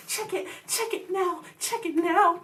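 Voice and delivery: high, pressing chant; pushy urging. Retold or imitated. Classmates pestering her in gym, high and insistent. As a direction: needle her to look right now.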